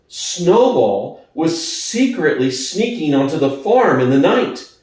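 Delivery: plain reading voice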